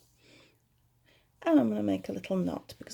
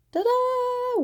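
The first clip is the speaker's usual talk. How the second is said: cheerful ta-da. Pretend. Reveals finished earring.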